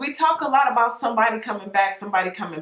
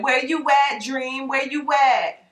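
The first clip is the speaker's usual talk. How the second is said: calling out for the dream; 5 semitones higher, slower than usual. Pretend. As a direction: call out as if you've lost track of it. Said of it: "your dream is like".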